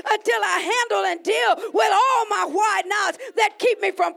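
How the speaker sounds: shouted, high, loud preacher's cry; preaching at full force. Acted. Pastor declaring to God, shouting high and loud. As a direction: preach it out, raising the roof.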